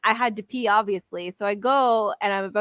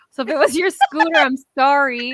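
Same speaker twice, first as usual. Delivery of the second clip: quick, louder playful sorry; mock apology; 4 semitones higher, 5 dB louder than usual. Real, not acted. Addressing the scooter owner with a mock apology. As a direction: apologize cheekily to the audience.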